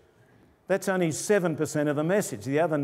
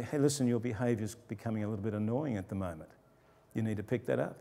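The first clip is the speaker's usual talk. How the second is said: softer, calm and even, just for the student; quiet calm word; 5 semitones lower, 6 dB quieter, faster than usual. Shown as a demonstration. Teacher quietly correcting a disruptive student, softer and calm. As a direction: lower your voice and settle the kid down. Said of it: "you can go over quietly and talk".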